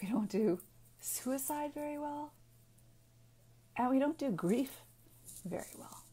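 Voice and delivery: choked up, near tears; real emotion. Real, not acted. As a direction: voice catching, pitch jumps up then fades to a breath. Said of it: "I'm, I'm getting emotional here".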